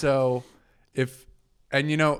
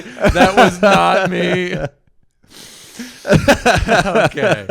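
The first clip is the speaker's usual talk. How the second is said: slow, loud, laughing denial; laughing shout; 6 semitones higher, 10 dB louder, slower than usual. Acted. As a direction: yell it through laughter, slow.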